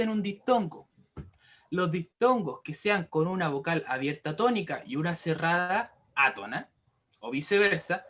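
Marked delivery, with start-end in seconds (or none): none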